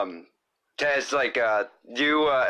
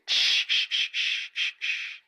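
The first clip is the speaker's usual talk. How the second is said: whispered shushing. Acted. Shushing sounds.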